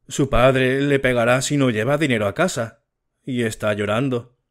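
plain reading voice

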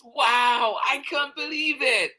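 very high, loud little Mouse voice; amazed excitement. Acted. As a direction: squeal it in childlike amazement. Mouse thrilled by the cookie.

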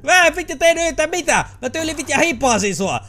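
high, loud protest of innocence; indignant yelling. Real, not acted. Yelled at by the guard, shouting back indignantly. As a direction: shout back that you did nothing.

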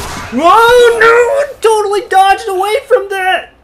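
drawn-out high panicked wail; very high, frightened gamer cries; terrified. Real, not acted. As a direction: shriek your way through a near miss. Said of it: "I'm so scared!".

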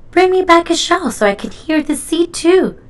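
a lightly voiced grandpa, bright with hope. A narrator's reading. Grandpa asking Splat for a shell, lightly voiced.

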